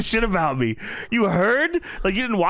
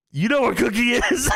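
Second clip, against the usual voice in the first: laughing; 7 semitones higher than usual. Retold or imitated. J Wow voiced, laughing.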